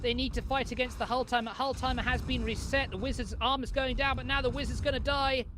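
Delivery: excited play-by-play. Real, not acted. Excited live play-by-play climax.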